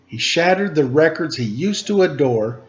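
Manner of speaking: plain reading voice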